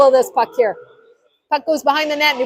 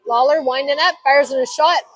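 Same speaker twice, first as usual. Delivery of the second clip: usual speaking voice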